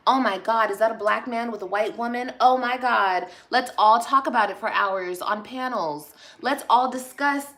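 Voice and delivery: loud, hammed-up shock; mock scandalized. Mimicking pundits fussing over a mixed couple, mock scandalized. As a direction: play the appalled gossip, punching the exclamations.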